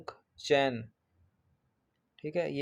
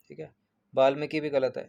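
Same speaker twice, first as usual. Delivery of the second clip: ordinary talk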